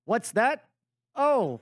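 fake phone call. Demonstrating a polite excuse to leave, play-acted surprise. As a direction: act out taking a sudden call. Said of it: "be a civilized adult and say".

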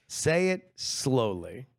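slow, deliberate request, lingering on each word; drawn-out slow. Real, not acted. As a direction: say it slowly, stretching every word. Asking for a slow reading.

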